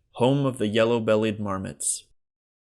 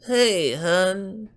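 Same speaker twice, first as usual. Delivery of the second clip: put-on much higher old lady voice; old Gladys; 10 semitones higher, 3 dB louder than usual. A narrator's reading. Gladys calls to Leonard, fond. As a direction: coo it like a doting wife.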